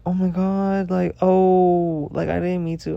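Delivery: high-pitched imitation of an apologetic friend; mock flustered apology.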